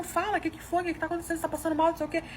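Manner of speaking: high, pressing questions; retold desperation. Wife begging her husband to say what happened, desperate. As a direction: act out worried pleading for an answer. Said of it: "comecei a me desesperar, falando".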